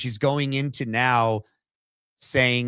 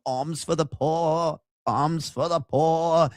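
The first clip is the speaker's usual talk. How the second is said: higher, slow whiny beggar plea; 4 semitones higher, slower than usual. Retold or imitated.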